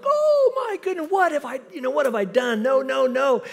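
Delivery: high, loud mock panic. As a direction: squeal in horror at the fine. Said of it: "And I'm like".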